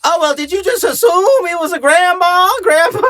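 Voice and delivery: hammed-up falsetto; mocking effeminate voice. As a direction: sneer it like a scandalized activist. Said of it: "they're all like".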